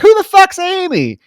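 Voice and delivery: high, loud indignant demand; mock jealous outrage. Lily confronting Max about the stranger, high outraged squeal.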